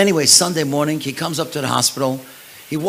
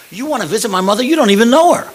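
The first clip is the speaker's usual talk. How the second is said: higher, louder retold disbelief; incredulous; 7 semitones higher, 8 dB louder, faster than usual. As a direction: imitate a stunned man, rising to a loud finish. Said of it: "This guy's almost falling out of the car".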